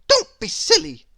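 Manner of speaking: high, loud brush-off; dismissive cry. A narrator's reading. Little Miss Stubborn scoffs at the worm, high and loud. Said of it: "cried little Miss Stubborn".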